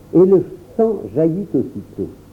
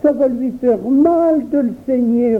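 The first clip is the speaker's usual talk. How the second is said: put-on high, tearful child's worry; en pleurant; 9 semitones higher than usual. A narrator's reading. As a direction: fret through tears. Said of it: "pleurant toujours". (enfant qui s'inquiète en pleurant, voix aiguë)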